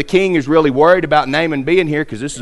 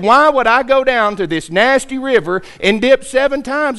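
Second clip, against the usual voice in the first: higher-pitched indignant retelling; wrathful complaint; 8 semitones higher than usual. Retold or imitated. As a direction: spit out the insult to the river, pitch up. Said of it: "The Bible said he was wroth".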